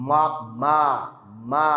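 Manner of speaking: মা drawn out with an open mouth; open-lip long a demo. Shown as a demonstration. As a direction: say মা with lips open, no rounding. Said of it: "ঠোঁট খোলা থাকবে".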